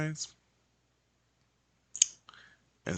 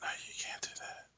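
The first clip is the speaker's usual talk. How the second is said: fast hushed objection; whisper; 15 dB quieter, much faster than usual. Retold or imitated. Imagined client objecting, whispered. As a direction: whisper it back quickly. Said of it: "Oh, but you're whispering".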